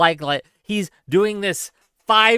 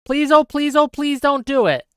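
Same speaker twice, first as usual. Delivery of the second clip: pleading, rising higher each time; mock begging; 6 semitones higher than usual. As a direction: beg on your knees, hammed up. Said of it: "I'm on my knees here, gang. I'm begging you".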